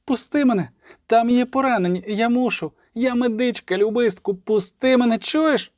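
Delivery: high-pitched desperate pleading cry; a young medic crying out to be let go; terrified young girl. A narrator's reading. Medic begs to run to the wounded, terrified high cry. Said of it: "жахливо кричала".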